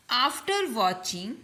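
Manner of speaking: slow, deliberate teacher dictation; slow example reading. Shown as a demonstration. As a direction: read the model sentence slowly, word by word. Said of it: "teacher reads example sentence".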